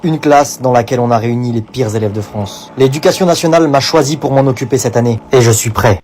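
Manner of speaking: voix off grave et lente; voix de bande-annonce. Acted. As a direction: annoncer comme un film d'action. (narrateur de bande-annonce, voix grave)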